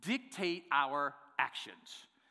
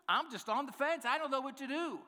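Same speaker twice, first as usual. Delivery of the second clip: higher, fretting, pretend helpless; mock-fretful higher voice; 8 semitones higher than usual. Shown as a demonstration. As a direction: whine it like you truly can't decide. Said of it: "I don't even get that one".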